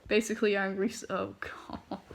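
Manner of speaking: ordinary delivery, nothing marked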